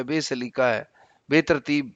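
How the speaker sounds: ordinary talk, nothing marked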